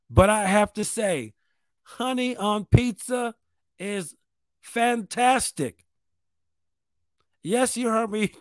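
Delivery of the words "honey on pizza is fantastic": slow, spaced-out words with a pause before "fantastic"; "honey on pizza" drawn out, then "fantastic" slow and falling; dramatic slow declaration. Real, not acted. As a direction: land "fantastic" slowly like a confession.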